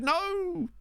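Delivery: high, drawn-out wail of protest; dismayed cry. Real, not acted. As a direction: stretch it out high in mock-tragic dismay. Reacts to a player's injury.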